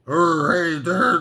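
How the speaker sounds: put-on rough go at an old film star; Humphrey Bogart. Comedian showing off a film star impression, mock Bogart. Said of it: "that's my Humphrey Bogart".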